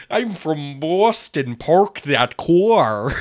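very slow, hammed-up Boston accent. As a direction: stretch every word in fake Bostonian. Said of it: "that's not a good Boston accent".

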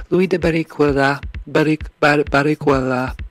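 loud, high playful name-calling; funny voice. Pretend. Podcaster playing with guest's name after thanking him, loud funny voice. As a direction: belt out the name in a silly voice. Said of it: "making funny sounds with your voice".

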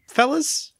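high rising call; high-pitched call. Acted. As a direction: pitch the word way up. Host calling the co-hosts.

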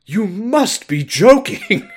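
loud, high, slow mock outrage; indignant Aced. Teased bear-masked man answers the group, mock-indignant. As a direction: exclaim it in a hammed-up, affronted character voice. Aced's imagined reply to being teased.

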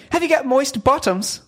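high, mock British mum asking her daughter; British mother. British mother teasing her soaked teenage daughter, mock British accent. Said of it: "I guess they were British".

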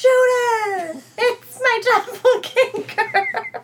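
high-pitched, drawn-out wail; hammed-up cry of alarm, stretched out; mock panicked cry. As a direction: play a panicked impostor, high and wailing. Acts out panicked fake empress.

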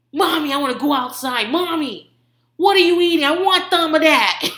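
high and building to loud; mock pushy kid, demanding harder and harder; aggressive toddler imitation. Three-year-old demanding mommy's food, aggressive toddler imitation. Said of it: "like, so aggressive".

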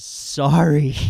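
loud, hammed-up sobbing apology; mock sobbing. Mom letting her son drop from a cliff, mock sobbing. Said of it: "She's, like, sobbing".

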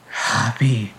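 injured man choking out a name; choked-out name. Acted. Woozy injured man greets his rescuer, choked out. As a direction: force the name out through a choke. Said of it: "All he can do is choke out".

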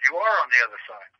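usual speaking voice

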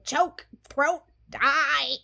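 hammed-up murderous chant, word by word; Chucky. Co-host acting out Chucky choking the cop, mock menace. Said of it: "Chucky at this point is just going".

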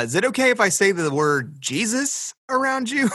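mock-polite question. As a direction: ask it like a painfully careful friend.

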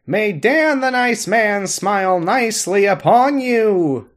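slow, solemn blessing voice; hammed-up congregation prayer; mock prayer. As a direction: intone it like a benediction. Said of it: "lead our congregation in the Doctor's Prayer".

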